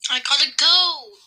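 loud, high, drawn-out cheer; excited shout. Real, not acted. Player announcing new goat to friend, excited shout. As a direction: crow about a lucky win.

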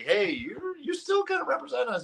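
high-pitched mock boss; pleading, high-voiced reprimand; scolding employer. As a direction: imitate a boss gently telling you off. Voices his employers scolding him.

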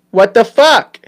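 high, loud imitation of a child; mimicked kid swearing. Kid marches in repeating dad, high loud mock child voice. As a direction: parrot it like a brash little kid. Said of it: "My kid comes marching in and goes".

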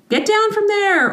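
high, sharp teacher's scold. Retold or imitated. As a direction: call it out like a teacher catching a kid climbing. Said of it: "teacher Lisa finds it very difficult not to school".

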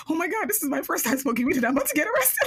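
high, fast, laughing panic; panicked laugh. Retold or imitated. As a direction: rush it out half-laughing in mock dread. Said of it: "I'm like".

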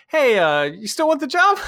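high, honeyed mock-polite question; sweet phone voice. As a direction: sweeten it up to win her back. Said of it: "I'm like".

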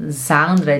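Sandra starting with a voiced Z sound. Shown as a demonstration. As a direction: say "Sandra" as "Zandra", slightly low. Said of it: "de Z". (Sandra com Z inicial, à alemã)

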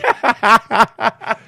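loud, high burst of laughter; cracking up at his own joke; big laugh. Real, not acted. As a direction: let a real laugh burst out. Laughs after his punchline.

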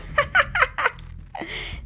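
high-pitched burst of laughter; hard laughing. A narrator's reading. Nakoma at the Kocoum imitation, laughing hard. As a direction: crack up at a friend's joke. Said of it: "Nakoma laughed so hard".

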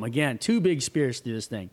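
ordinary talk

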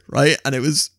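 ordinary talk

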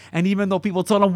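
ordinary talk, nothing marked

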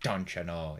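lightly put-on Minnesota accent. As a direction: imitate a Minnesotan catchphrase. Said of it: "like, Minnesota".

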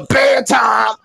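put-on mother cutting off the child; mama's bedtime order again. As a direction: bark it just like mama. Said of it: "I be like".

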